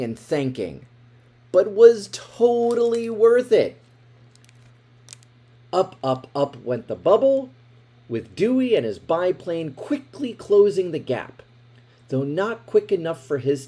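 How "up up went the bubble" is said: ordinary delivery, nothing marked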